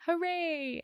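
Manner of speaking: a hint of a little boy's high hooray; child's cheer. Son answering his mother from his car seat, high cheer. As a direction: echo the cheer like a small boy. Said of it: "Kimball would yell back to me".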